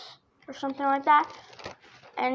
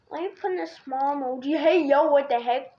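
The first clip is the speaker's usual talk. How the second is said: usual speaking voice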